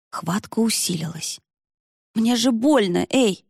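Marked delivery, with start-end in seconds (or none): 2.1-3.4 mildly indignant protest, pitched up. A narrator's reading. Varya protesting at the tightening grip, indignant. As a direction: object to being hurt, a bit higher. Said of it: "возмутилась Варя".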